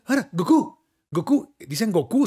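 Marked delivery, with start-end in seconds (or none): 0.3-1.5 Goku said go-KU, rising on KU; stress on last syllable. Shown as a demonstration. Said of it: "así lo oímos en el japonés". 1.9-2.3 Goku said go-KU, lifting on KU; stress on last syllable. Shown as a demonstration. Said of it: "nosotros decimos Goku".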